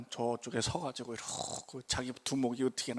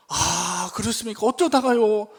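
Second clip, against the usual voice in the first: louder, higher mock concern; alarmed reply; 6 semitones higher, 7 dB louder than usual. Pastor hearing of the injury, loud mock alarm. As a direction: sound shocked for the boss.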